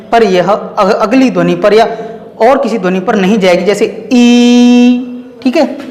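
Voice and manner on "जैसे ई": ई held long and steady; prolonged vowel. Shown as a demonstration. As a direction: sustain ई without changing the sound. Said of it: "कितना भी लंबा बोल लें".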